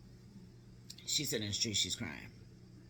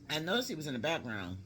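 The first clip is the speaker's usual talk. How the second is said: usual speaking voice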